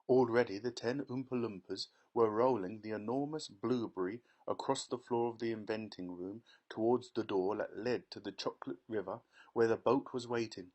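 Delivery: usual speaking voice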